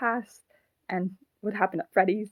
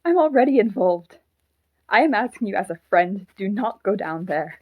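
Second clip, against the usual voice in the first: louder, urgent insistence; heated warning; 8 dB louder than usual. Acted. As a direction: push back forcefully, then press the warning. Puppet argues to stop Mike.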